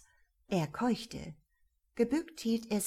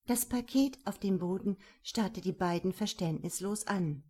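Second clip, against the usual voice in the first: ordinary delivery, nothing marked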